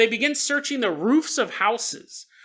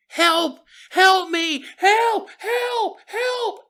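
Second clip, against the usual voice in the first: cries for help; 11 semitones higher, much slower than usual. Acted. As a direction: yell for help while being carried off. Man carried off screaming.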